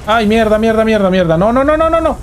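high, loud panicked swearing; panicked cursing. Real, not acted. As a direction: yelp out the curses in alarm. Attacked suddenly in game.

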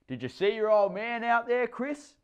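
high, loud hearty call; jolly stepdad. A narrator's reading. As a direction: try to win the boy over. Stepdad calling to boy.

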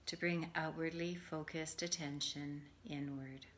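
calm, measured meditation voice with long pauses; guided meditation. Acted. Yoga teacher settling listeners for Yoga Nidra, guided meditation. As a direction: ease them gently into rest, phrase by phrase.

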